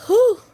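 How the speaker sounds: high, loud whew; loud puff of relief-seeking breath; heavy exhale. Real, not acted. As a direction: blow out a big breath to settle yourself. Blowing out to calm herself.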